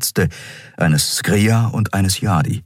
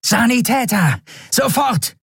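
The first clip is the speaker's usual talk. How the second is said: much higher urgent call; calling for medics. A narrator's reading. Shia calling the escort for medics, urgent high call. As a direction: yell for the medics right now.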